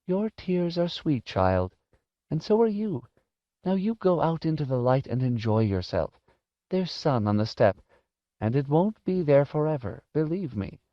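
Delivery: put-on gentle old-lady voice, lifting high; high, affectionate elderly woman; tender old woman. A narrator's reading. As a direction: comfort a child and kindly send them off. Voices Missus Griffin comforting Harvey.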